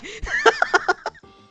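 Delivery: very high shrieking laugh. Real, not acted. Laughing at story.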